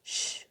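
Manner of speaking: voiceless hushing "shh"; shushing. A narrator's reading. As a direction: quickly shush an excited friend. Boy hushes friend in the hall.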